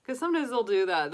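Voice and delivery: ordinary talk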